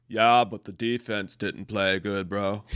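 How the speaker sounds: low, slow dopey bro voice; slow, deep sports-fan bro; other guy in argument. Retold or imitated. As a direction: drag it out low like a sulky bro. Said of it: "the other guy's like".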